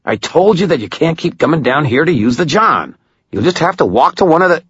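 high-pitched, exasperated rant; snarled scolding. A narrator's reading. Maroon tells off Stu for coming down, snarling. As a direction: scold a pestering coworker, cut off mid-sentence. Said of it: "he snarled".

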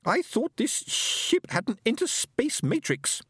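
high, slow alien voice; a lofty alien speaking slowly and high; stately high alien voice; alien Fenobe. Acted.

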